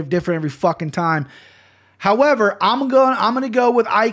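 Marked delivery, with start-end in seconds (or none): 2.0-4.1 pumped, loud and high; building excitement for the underdog pick; hyped up. Real, not acted. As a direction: sell the pick with big energy.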